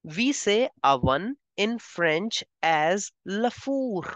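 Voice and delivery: usual speaking voice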